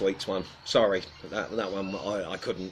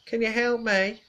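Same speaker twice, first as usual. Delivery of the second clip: put-on higher, thin old woman's voice; imitating old lady; 11 semitones higher than usual. Retelling a hidden old lady calling for help, mock frail. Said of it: "this disembodied voice says".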